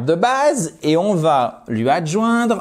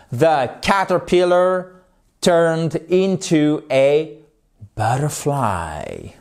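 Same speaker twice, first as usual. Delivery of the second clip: slow model reading; slower than usual. Shown as a demonstration. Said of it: "teacher modelling example sentence".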